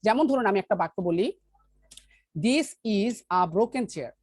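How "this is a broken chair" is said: very slow, spaced-out example sentence. Shown as a demonstration. Teacher giving the class an example sentence, very slowly. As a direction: lay out each word of the example slowly. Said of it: "teacher gives an example sentence".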